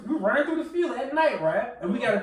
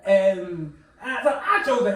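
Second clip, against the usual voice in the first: ordinary delivery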